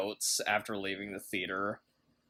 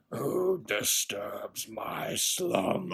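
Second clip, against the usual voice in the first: slow, rumbling stone cave voice; Cave of Wonders voice; much slower than usual. Retold or imitated. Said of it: "kinda sounded like the Cave of Wonders".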